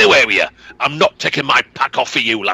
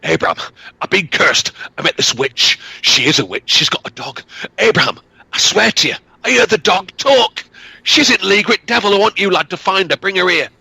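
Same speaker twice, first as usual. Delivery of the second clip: frightened cursed man; 7 semitones higher than usual. Acted. Cursed father pleads with son.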